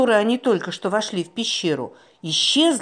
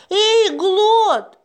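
high, loud call; 5 dB louder than usual. A narrator's reading. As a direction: shout the name into the cave. Calling out to the island.

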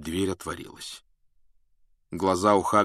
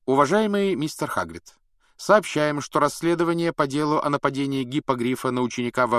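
raised, formal reading voice; official letter; 8 semitones higher, 5 dB louder than usual. A narrator's reading. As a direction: read out the letter stiffly.

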